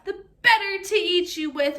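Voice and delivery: loud wolf roar. Acted. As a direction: roar it out, menacing. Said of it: "roared the wolf".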